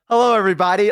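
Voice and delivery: bright mock stream intro; streamer greeting. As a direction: open the stream like a host. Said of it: "start stream with you".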